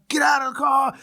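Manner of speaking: high-pitched shouted command; imitating a yelling cop; barked police order. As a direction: order someone out at gunpoint. Retold cops ordering him at gunpoint.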